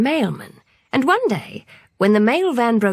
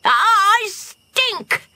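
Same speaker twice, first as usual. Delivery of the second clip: high, stretched-out wail of disgust; dismayed cry; 11 semitones higher, 3 dB louder than usual. A narrator's reading. Benny reacts to the skunk spray, high horrified cry. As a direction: cry out in horror at yourself. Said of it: "cried Benny".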